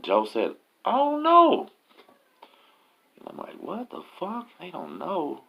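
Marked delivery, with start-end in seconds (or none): 0.8-1.7 very high hollered answer; high-pitched distant-reply holler; imitated call back. Joe calls back from below. 3.6-5.5 hushed, high-pitched disbelief; soft incredulous squeak; retold bafflement. His baffled reaction to the answers.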